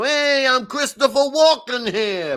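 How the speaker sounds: loud, high, slow, ridiculous New York accent; full over-the-top New York Walken; Christopher Walken impression. Shown as a demonstration. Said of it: "really ridiculous New York".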